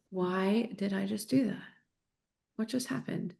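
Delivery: a mom turning a snap into a soft, curious question; curious, gentle tone. Retold or imitated. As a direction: ask yourself softly, with real curiosity. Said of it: "The tone is so different".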